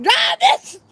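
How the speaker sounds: very high shrieked command; piercing, panicky yell, played big; hammed-up panic. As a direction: shriek it at the getaway driver in mock panic. Retold panicked order to driver.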